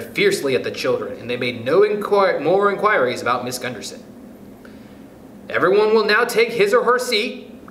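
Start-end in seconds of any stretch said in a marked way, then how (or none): 5.5-7.7 higher-pitched teacher's order; commanding. A narrator's reading. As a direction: give the class a firm order. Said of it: "commanded Stuart".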